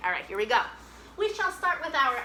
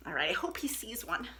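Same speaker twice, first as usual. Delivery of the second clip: 1 whispered aside; 3 semitones lower, 7 dB quieter than usual. Real, not acted. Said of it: "whispered aside".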